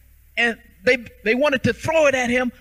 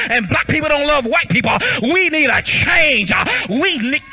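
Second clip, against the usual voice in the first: rising preaching; 2 semitones higher than usual. Real, not acted. Preacher stirring the congregation, building. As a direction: hammer the tired of going refrain home.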